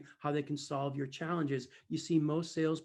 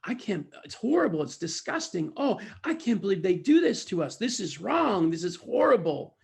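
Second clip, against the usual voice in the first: raised, loud swoops of outrage; mock indignation; 5 semitones higher, 7 dB louder than usual. Buyers saying how they feel, mock outraged. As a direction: imitate people appalled at being wronged. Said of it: "How do you feel about this?".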